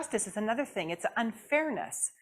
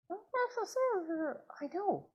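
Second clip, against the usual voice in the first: puppet voice; 10 semitones higher, 6 dB quieter than usual. Acted. Puppet Jasmine speaks.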